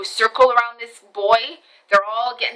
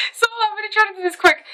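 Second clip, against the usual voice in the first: high, rushed and giggly; hurried aside; 10 semitones higher, much faster than usual. Real, not acted. Storyteller whose phone is dying, high hurried aside. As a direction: race through it in a high pitch.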